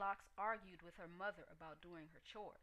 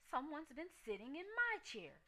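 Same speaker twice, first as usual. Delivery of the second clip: higher mama bear voice, a bit louder; Mama Bear yelling; 8 semitones higher, 5 dB louder than usual. A narrator's reading. Mama Bear finds her porridge touched, higher mock yell. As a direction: voice a fussed mother bear, raised. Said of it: "yelled Mama Bear".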